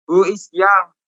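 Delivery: ordinary talk, nothing marked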